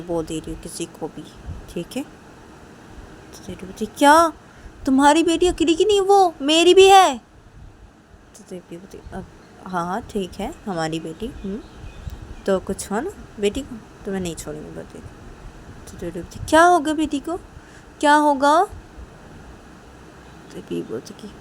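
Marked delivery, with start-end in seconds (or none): 3.9-7.2 high, loud angry protest; outraged, raised-voice objection; indignant outburst. Acted. Acted quarrel over whose daughter she is. 16.5-18.5 loud, high-pitched demand; affronted and loud; indignant challenge. Acted. Ruhi challenges the warning, acted.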